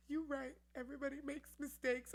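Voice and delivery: high, moved, lightly voiced; humbled agreement. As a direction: concede to the child. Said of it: "I'm like".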